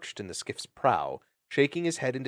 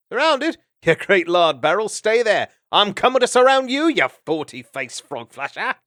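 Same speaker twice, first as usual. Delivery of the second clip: mock battle shout; 11 semitones higher, 10 dB louder than usual. Gonf shouting back, mock fight.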